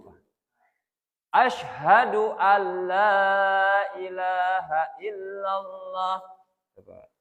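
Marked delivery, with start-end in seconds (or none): 1.3-6.2 slow, careful recitation of the shahada; recited slowly. Shown as a demonstration. (bacaan tahiyat pelan)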